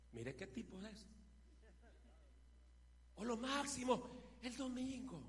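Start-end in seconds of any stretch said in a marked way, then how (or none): none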